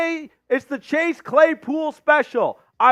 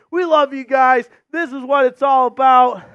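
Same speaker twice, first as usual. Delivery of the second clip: usual speaking voice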